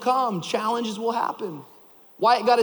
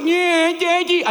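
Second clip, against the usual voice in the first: put-on whimpering toddler; 9 semitones higher, 5 dB louder, slower than usual. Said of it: "He's like".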